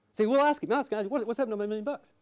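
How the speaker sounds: high-pitched, agitated gangster impression; urgent demand. Mister Big orders the lawyer to ask the accountant, agitated. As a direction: push the order out high and insistent.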